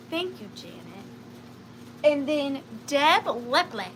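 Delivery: normal talking voice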